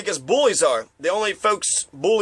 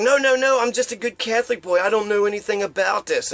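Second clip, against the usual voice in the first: mock innocent protest; 4 semitones higher than usual. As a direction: protest your innocence too eagerly.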